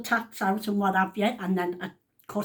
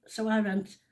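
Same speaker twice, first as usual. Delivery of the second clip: usual speaking voice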